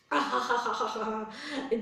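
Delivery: long staccato ha-ha-ha; drawn-out mocking storybook laugh; scornful acted laugh. Rabbit laughs off the race challenge, scornful acted laugh. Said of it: "Then this rabbit said".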